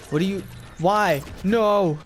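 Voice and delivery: yelled protest, peaking on "No!"; real alarmed shout. Real, not acted. Startled by an attack during gameplay.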